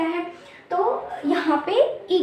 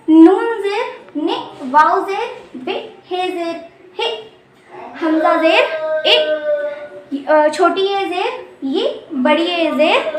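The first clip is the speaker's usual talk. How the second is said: slow, louder teacher recitation; emphatic letter drill; 4 dB louder, much slower than usual. Shown as a demonstration. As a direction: call each letter out clearly to the class. (ज़ोर से धीमे-धीमे अक्षर बोलना)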